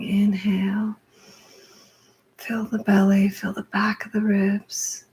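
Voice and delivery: slow, spaced-out breathing cues with drawn-out words; guided breathwork. Real, not acted. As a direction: lead the breath, pausing after each cue. Leads a guided breathing exercise.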